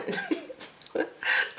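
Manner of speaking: a short airy laugh way up high; high breathy giggle. Real, not acted. To viewers, joking about wrinkled shirts: high airy giggle. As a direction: giggle at your own joke.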